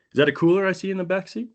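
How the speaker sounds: a light take on a prying officer; female cop. Retold or imitated.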